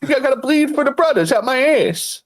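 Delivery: high-pitched comic rant voice; Bill Burr. Acted. Gang member bleeding for the brothers, high comedian voice. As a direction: push it up into a comedian's impression. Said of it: "progressively starting to sound like Bill Burr".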